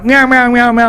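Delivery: high-pitched mock meows; imitated meowing. Imitates sound from a recording.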